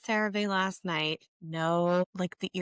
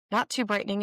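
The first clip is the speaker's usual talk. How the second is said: ordinary delivery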